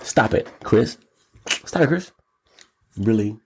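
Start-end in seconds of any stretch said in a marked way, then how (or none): none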